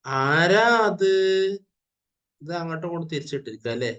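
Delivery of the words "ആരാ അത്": high, loud and very slow; loud and drawn out. Shown as a demonstration. Teacher asks class to say it loudly, slow loud call. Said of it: "എല്ലാരും ഒന്ന് ഉറക്കെ പറഞ്ഞേ".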